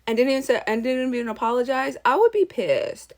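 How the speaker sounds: normal talking voice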